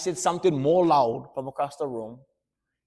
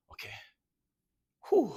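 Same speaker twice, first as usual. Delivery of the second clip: ordinary delivery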